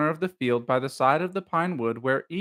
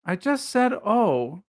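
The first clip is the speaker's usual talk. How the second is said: nervously; 7 semitones higher, slower than usual. A narrator's reading. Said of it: "said Piglet nervously".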